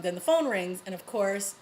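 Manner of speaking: ordinary delivery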